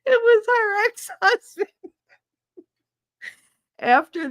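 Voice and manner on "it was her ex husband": high, loud laugh-crying through the reveal; real laughter, high and loud. Real, not acted. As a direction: squeal the punchline through laughter. Punchline laughing.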